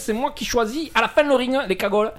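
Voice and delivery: bossy high impression of the host; Christophe Palmer impression. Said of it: "c'est encore moi, Christophe Palmer".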